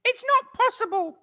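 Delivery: put-on high, loud old-woman cry; crying out in alarm. Missus Twit frightened, crying out to her husband. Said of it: "Missus Twit".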